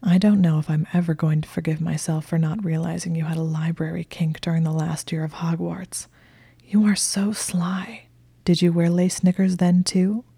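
plain reading voice

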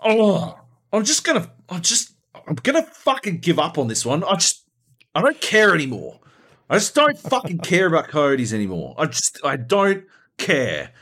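high, loud exasperated rant; hammed-up petulant raging; pissed-off friend. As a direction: imitate a fed-up gamer giving up. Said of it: "pissed. He's like".

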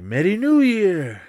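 "Merry New Year" high, loud and slow in a put-on Cameroonian accent. Disguised student greeting the train party in a Cameroonian accent. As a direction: greet them as the fake Cameroonian student, drawn out. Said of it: "exchange student from Cameroon".

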